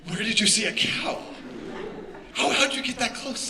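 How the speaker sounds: put-on loud, high amazed kid. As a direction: gasp out the questions in wonder. Playing his amazed kid self.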